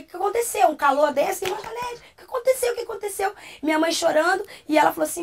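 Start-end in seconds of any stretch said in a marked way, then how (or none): 0.0-3.3 high-pitched, startled questions; retold alarm, pitched well up; alarmed questioning. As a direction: act out an elder's startled concern. Elder's acted alarmed questioning.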